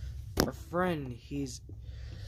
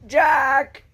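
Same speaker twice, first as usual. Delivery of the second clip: high, loud shout of a name; sharp, raised holler; calling out; 12 semitones higher, 9 dB louder than usual. Acted. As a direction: yell after a friend. Calls after departing friend.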